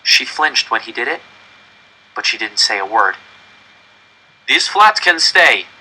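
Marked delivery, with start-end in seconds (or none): 4.5-5.7 put-on loud, high, slow German-accented verdict; German officer. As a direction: pronounce it like a judgment. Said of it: "the German officer said".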